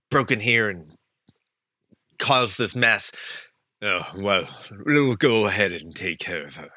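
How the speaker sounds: a bored guard taking charge; guard questioning. Acted.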